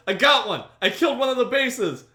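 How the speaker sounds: high, loud gleeful yell as Jay; retold triumphant yell. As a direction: shout it like a proud beginner who finally scored a kill. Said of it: "Jay yells".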